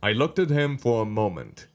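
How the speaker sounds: unhurried storytelling with pauses between phrases; story narration. A narrator's reading. Reads the story aloud.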